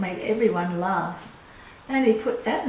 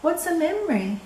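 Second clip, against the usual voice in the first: put-on raised childlike asking voice; childlike question; 8 semitones higher than usual. Little boy asking an old neighbour, voice raised. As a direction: ask it like a curious little boy.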